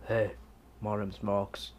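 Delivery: low, soft action-hero impression; Mad Max. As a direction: drop low and quiet with a bad Australian accent. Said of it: "Let's see how Mad Max talk".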